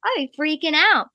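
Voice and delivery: high and loud, gushing fan excitement; excited agreement. Retold or imitated. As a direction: cheer her on, totally starstruck. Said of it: "I'm like".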